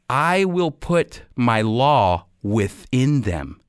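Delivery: normal talking voice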